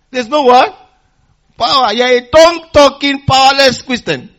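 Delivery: loud rebuking preacher shout; fiery preacher scolding the church; preaching call-out. Real, not acted. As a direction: call it out, then hammer the rebuke.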